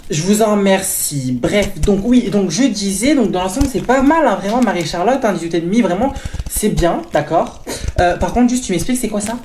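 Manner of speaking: loud, overbearing mom voice; loud pushy mother; loud mother character. Acted. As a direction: play the pushy mother booming over everyone.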